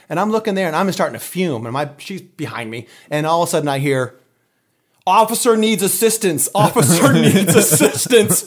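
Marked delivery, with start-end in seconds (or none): 5.1-8.5 higher, louder dispatch call breaking into laughter; slow radio call cracking up with laughter; mock radio call. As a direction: announce it like a police radio. Mimicking police radio call.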